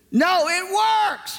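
very high, drawn-out imitated yell; slow, high mock shout; insistent outburst. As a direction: mimic an exasperated caller insisting.

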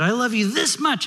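put-on high-pitched little bunny child; little kid rabbit. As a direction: pipe up like a small child telling Dad. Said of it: "the little kid rabbit says to his dad".

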